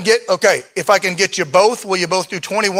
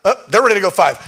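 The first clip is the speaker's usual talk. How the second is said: ordinary delivery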